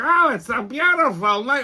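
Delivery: high, put-on contented voice; mocked woman. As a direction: mimic her enjoying the evening, mockingly. Mocks the woman sitting there.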